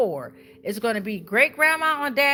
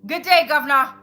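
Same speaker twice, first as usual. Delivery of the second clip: second go at the British greeting, louder and hammed-up; louder British accent; 2 semitones higher, 8 dB louder than usual. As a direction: belt out good day governor in a mock British accent. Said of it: "I tried to do my U K voice".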